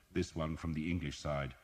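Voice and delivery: ordinary delivery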